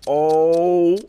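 long, loud, high "oh" of dismay; dismayed wail. Real, not acted. Player finding the gun empty, dismayed wail.

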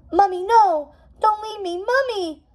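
put-on high childish wail. A narrator's reading. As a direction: cry out like an abandoned little boy. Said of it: "Prince John wailed, his voice louder".

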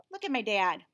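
plain reading voice

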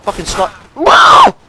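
high, loud cry as it cuts off; sudden high shout; startled yelp. Real, not acted. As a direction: yelp mid-word at being hit. Sniped mid-sentence while playing.

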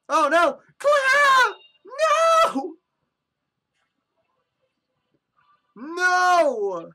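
loud, high panicked shouts; loud high horrified yells; anguished cries. Acted. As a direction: scream as your friends lose faces. Villain steals friends' faces.

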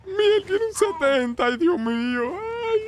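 high, slow, hammed-up crying; put-on tearful high voice; mock wailing. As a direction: wail it like a tragic lament. Mock grief over remembered motorbike.

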